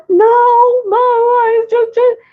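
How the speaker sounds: put-on high, drawn-out kid's wail; hammed-up, high whimpering excuse; mock crying. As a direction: imitate a child blubbering to mom. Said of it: "and he's crying".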